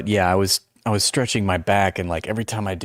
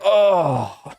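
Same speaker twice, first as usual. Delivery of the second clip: high, drawn-out "oh" of pain; loud re-created back-stretch groan; re-enacted groan; 10 semitones higher, 4 dB louder than usual. Streamer re-enacting his back stretch for viewers, pained groan. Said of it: "I was like".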